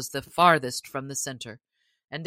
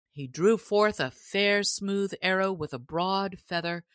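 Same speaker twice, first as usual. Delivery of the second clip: ordinary delivery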